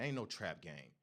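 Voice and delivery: ordinary talk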